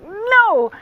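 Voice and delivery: loud, high, drawn-out "no"; exasperated high wail of refusal; emphatic refusal. Retold or imitated. Acts out refusing to be held.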